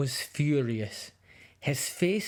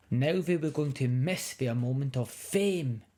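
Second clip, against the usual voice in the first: plain reading voice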